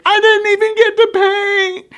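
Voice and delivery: put-on high, loud whining little girl; whiny child. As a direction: complain like a disappointed child. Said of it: "she was like".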